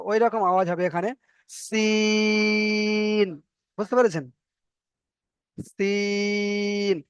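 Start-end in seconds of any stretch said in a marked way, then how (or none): 1.5-3.3 সিন with a long airy hiss, like a tyre going flat; hissing like a leaking tyre. Shown as a demonstration. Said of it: "cycle থেকে হাওয়া বের হয়". 5.7-7.0 সিন with a long airy hiss, like a tyre going flat; hissing like a leaking tyre. Shown as a demonstration. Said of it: "এর উচ্চারণটা হলো এমন ভাবে".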